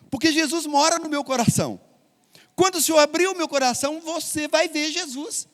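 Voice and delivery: put-on a small child insisting with innocent certainty; child's voice. Preacher voices child about Jesus.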